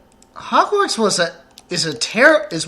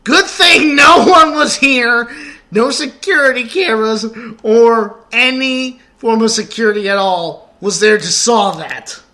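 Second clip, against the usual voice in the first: sarcastic shout; 8 semitones higher, 11 dB louder than usual. Real, not acted. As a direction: shout it with mock relief. Sarcastic relief after theft.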